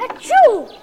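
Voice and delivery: big fake sneeze aloud; loud sneeze. Narrator acts a sneeze.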